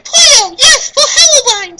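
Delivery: very high, loud, slow squeak; high laughing creature voice; squeaky creature. Acted. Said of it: "said Kirby".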